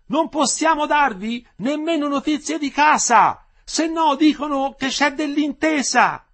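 high, drawn-out full-voiced call; woman shouting out. A narrator's reading. Woman calling up to newly arrested prisoners, shouting. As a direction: call loudly to reach men inside. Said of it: "una delle donne gridò".

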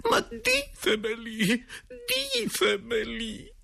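very high, slow falsetto pleading; hammed-up wailing entreaty; begging directress. A narrator's reading. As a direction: plead tearfully, stretching the words. Directress begs the ghost.

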